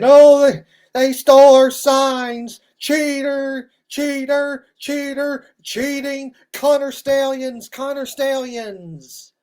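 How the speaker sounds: high, loud whining mockery about stolen signs; sarcastic high-pitched crybaby imitation; mock whiny fan. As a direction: whine like a sore loser crying cheater. Mocking fans crying cheating.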